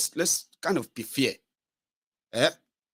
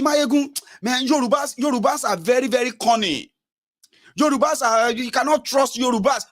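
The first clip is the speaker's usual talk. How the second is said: mock gossiping flatterer; 8 semitones higher than usual. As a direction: imitate someone slandering Yorubas, pitched high.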